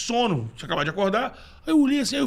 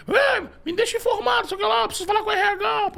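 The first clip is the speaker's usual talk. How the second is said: bossy boss voice; 14 semitones higher than usual. Retold or imitated. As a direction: bark it like the boss. Boss Wagner voiced on phone.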